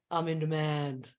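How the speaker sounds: deep, measured mantra, falling at the end. Shown as a demonstration. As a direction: drop low and declare it. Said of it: "just lower your voice and say".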